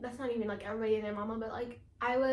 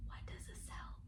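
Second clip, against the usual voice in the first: whisper; 13 dB quieter than usual. Real, not acted. Mumbling the joke to herself.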